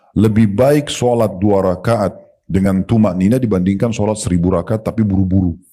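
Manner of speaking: ordinary talk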